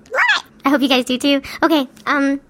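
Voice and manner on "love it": high excited squeal of love it; gushing squeal. Real, not acted. Gamer gushing to listeners, squealing with delight. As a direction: squeal it, over the moon. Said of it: "Enough gushing".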